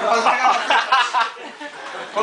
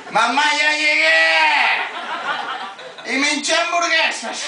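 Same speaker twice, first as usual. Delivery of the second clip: put-on drunken announcement; 8 semitones higher, slower than usual. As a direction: stretch it out like a proud drunk. Drunk teen announcing arrival.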